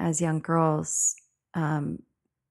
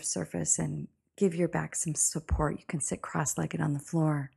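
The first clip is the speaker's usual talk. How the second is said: calm meditative tone. Acted. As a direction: breathe it out gently, soothing. Leads a guided meditation.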